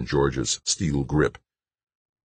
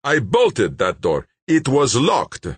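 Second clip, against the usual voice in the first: slow start, rising louder and higher; insistent gunman; 10 semitones higher, 4 dB louder, slower than usual. A narrator's reading. Gunman disputes the escape story, forceful insistence. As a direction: insist forcefully, building in pitch.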